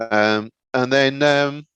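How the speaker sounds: usual speaking voice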